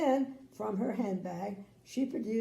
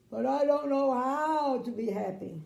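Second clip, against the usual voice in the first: loud, higher sniffle; sniffly king; 5 semitones higher, 7 dB louder than usual. Acted. King sniffs to Little Miss Sunshine, louder. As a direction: sniff it out sadly. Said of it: "sniffed the king".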